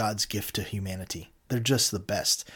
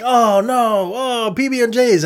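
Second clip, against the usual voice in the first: imitating houseguests, high put-on whiny groan; mock dismay; 11 semitones higher, 8 dB louder, slower than usual. Mocking the have-nots who get only PB&Js, high whiny dismay. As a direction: ham up the contestants' disappointment. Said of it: "And they're like".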